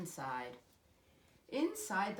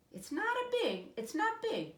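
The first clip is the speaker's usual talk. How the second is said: put-on high, eager boy; excited child; 5 dB louder than usual. A narrator's reading. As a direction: bubble over about the catch. Jimmy excited about catch.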